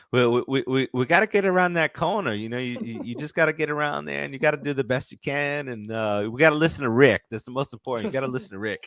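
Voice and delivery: imitated character accent, rambling and insistent; Herschel's accent. Imitating a Walking Dead character over games, put-on accent. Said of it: "in Herschel's accent".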